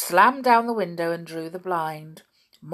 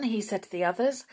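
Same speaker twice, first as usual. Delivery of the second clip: plain reading voice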